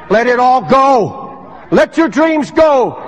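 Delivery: slow, high, rising charge to a shout; full-force preaching. Acted. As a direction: declare it over the broken preacher.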